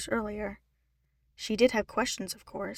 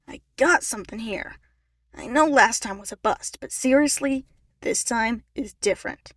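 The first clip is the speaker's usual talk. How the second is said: loud phone argument; 8 dB louder than usual. A narrator's reading. Ian arguing on phone.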